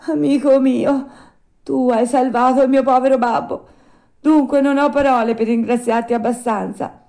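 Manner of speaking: high, loud voice full of emotion; moved. A narrator's reading. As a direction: thank a rescuer with heartfelt, moved intensity. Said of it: "con voce commossa gli disse".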